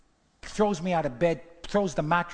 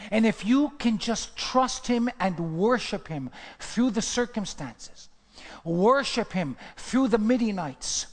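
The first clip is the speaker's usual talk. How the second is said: loud, slow, rising sermon climax; preaching at full force; 4 semitones higher, 4 dB louder, slower than usual. Acted. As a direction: drive it home to the congregation.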